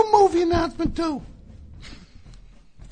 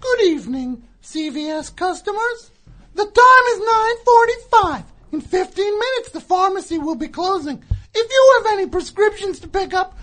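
over a PA system; 5 dB louder than usual. Shown as a demonstration. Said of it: "this is how you do it".

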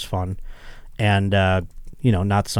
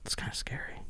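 half-joking hushed dread; mock scared whisper; 20 dB quieter than usual. Host whispers to friend about sleeping alone, mock scared. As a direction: whisper it like a spooked kid.

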